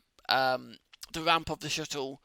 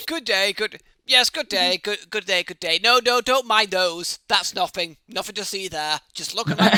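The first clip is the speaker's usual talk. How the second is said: higher, louder mock pompous greeting; hammed-up pompous officer; 5 semitones higher, 8 dB louder than usual. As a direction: play a silly disguised gentleman. GM mocks disguised player.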